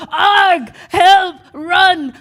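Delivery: short loud shouts of panic; mock alarm cries. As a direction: cry out as the frightened mob, hammed up. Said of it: "people take one look at me and go".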